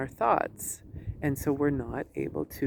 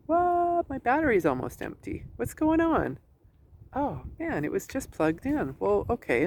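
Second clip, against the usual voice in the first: high, startled "What?" then puzzled grumbling; mock surprise at phone; 8 semitones higher than usual. Coach acting out a phone user finding the battery drained, mock shock. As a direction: act out staring at a dying phone battery. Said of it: "you look at it and go".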